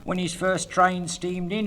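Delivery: normal talking voice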